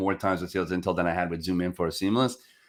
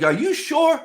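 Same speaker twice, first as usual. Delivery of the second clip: much higher, louder disbelieving question; incredulous prospect; 14 semitones higher, 5 dB louder than usual. Retold or imitated. Prospect asking again in high, loud disbelief. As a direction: squeak it in disbelief. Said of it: "he's like".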